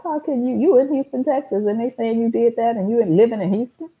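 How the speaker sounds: high-pitched, disbelieving question; the DA can't believe the accusation; incredulous DA. Retold or imitated. DA questioning the false report, pitched high in disbelief. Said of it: "the D A was like".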